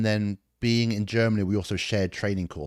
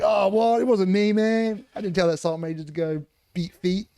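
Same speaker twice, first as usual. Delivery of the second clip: high, loud mock-innocent protest; feigned innocence; 12 semitones higher, 5 dB louder than usual. Soldier playing innocent to his commander, high and loud. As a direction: play dumb, voice jumping up high and loud. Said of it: "I'm like".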